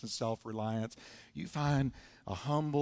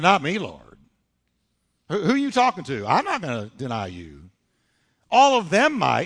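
cocky protest with big pitch swings; bragging; 8 dB louder than usual. Retold or imitated. Peter assuring Jesus he won't deny him, bragging. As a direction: protest loudly, full of mock bravado. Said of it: "look at how he bragged".

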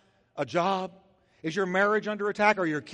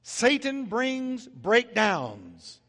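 very slow, every word weighed; preacher landing the closing line slowly; slow emphatic punchline; much slower than usual. Acted. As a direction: hammer the contrast word by word.

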